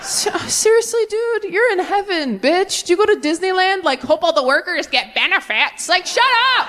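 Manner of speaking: 高めの声で苛立つ神様; exasperated God. Retold or imitated. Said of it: "And God's like".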